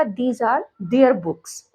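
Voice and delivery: each word said very slowly and apart; slow dictation. Shown as a demonstration. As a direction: spell it out slowly for students. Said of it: "तो कैसे कहा जाएगा?". (बहुत धीरे, शब्द-शब्द अलग करके)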